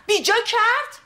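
indignant outburst. Acted. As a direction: explode with indignation. Nazanin flares up at Kati. (با خشم و صدای بلند)

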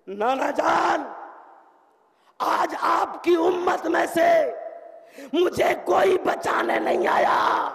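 high, slow, loud lament; anguished scream. Acted. Zainab calls to her grandfather for help, screaming out. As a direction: cry out slowly, each phrase ringing high. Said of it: "چیخ کے آواز لگائی".

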